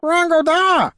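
very high, loud squawk; creature squawk. A narrator's reading. Creature protesting to the bard, loud squawk. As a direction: screech it like an indignant little creature.

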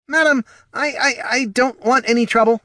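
high, loud nervous stammer; panicked stammer. Acted. Captive gnome stammering in panic.

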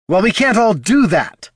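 high, loud sharp snap from Heraldon; snapped retort. A narrator's reading. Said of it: "snapped Heraldon".

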